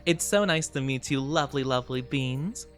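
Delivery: ordinary talk, nothing marked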